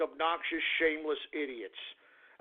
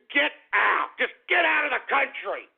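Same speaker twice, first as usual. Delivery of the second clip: higher, heated command; angry outburst; 4 semitones higher than usual. Real, not acted. Host telling opponents to leave the country, angry outburst. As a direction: order them out in sheer exasperation.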